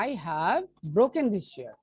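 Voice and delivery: slow, deliberate example sentence. Shown as a demonstration. Teacher giving students a second example, slowly. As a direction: set each word of the example apart. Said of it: "teacher gives an example sentence".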